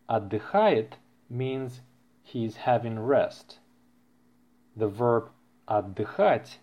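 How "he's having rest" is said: ordinary talk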